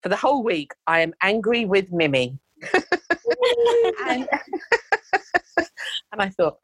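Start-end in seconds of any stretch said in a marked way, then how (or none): 2.6-4.0 bursting into a high laugh; a real laugh, pitched high. Real, not acted. Laughs at the client's diary entry. 4.3-6.1 bursting into a high laugh; a real laugh, pitched high. Real, not acted. Keeps laughing at the entry.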